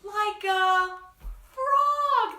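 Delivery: put-on high, loud answer for the kids; playful reveal. Triumphant answer to guessing game.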